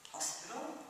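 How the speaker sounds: quiet, rising query; puzzled question. Acted. Son querying talk of his mother's hospital, puzzled. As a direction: ask softly, caught off guard.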